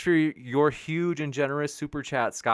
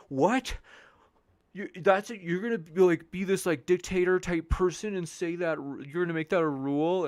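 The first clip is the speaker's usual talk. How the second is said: mock outrage; 7 semitones higher than usual. Mocking chatters who complain about rules.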